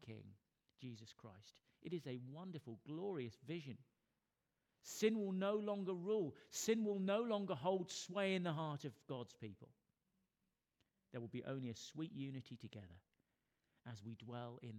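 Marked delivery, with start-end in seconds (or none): 4.8-9.7 loud, higher preacher's proclamation; forceful preaching. Real, not acted. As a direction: declare it with full conviction to the congregation.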